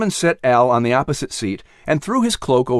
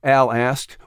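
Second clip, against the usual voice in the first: plain reading voice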